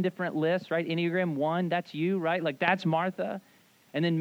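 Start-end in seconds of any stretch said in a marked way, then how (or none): none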